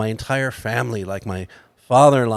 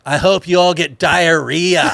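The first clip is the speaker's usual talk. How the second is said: louder, slower mock curse; slow, raised-voice spite, played for laughs; retold angry curse; 4 semitones higher, 5 dB louder than usual. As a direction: imitate a sore diner's parting shot. Retold parting curse from angry diner.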